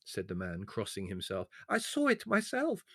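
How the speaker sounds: high, slow, wheedling complaint; whining villager. Acted. The servile peasant complains to Leslie: high wheedling whine. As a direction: plead your loss with fawning humility.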